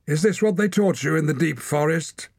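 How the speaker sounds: high loud mocking shout; heckling. A narrator's reading. Watcher heckles the boy, high loud mocking shout. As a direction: jeer it across the ring.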